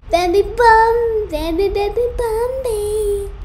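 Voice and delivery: straining for the high notes, voice cracking. Real, not acted. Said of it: "Voice cracked right there".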